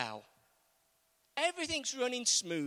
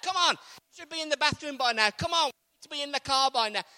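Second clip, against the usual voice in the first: louder, impatient hurrying; mild mock hurrying; 4 semitones higher, 7 dB louder than usual. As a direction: hurry the kids out, mildly impatient. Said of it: "You talk to your kids like that".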